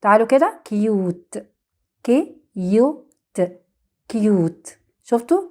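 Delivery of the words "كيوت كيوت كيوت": كيوت with a long "yoo" and silent final E; U by its name. Shown as a demonstration. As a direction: say كيوت slowly in parts, K, "yoo", T. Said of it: "نطقناه باسمه مش بصوته".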